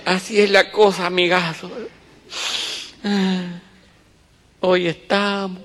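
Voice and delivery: a teary philosopher over the coffin, lightly voiced; sentimental mourner. Sentimental mourner tells his pal life is short, mock-solemn. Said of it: "le habló a su compañero".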